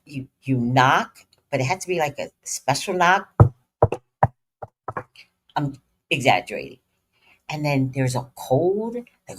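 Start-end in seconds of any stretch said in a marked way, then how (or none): none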